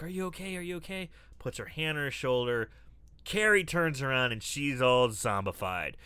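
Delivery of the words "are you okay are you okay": quiet, worried check-in; soft concerned voice. Retold or imitated. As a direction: gently ask if she's hurt.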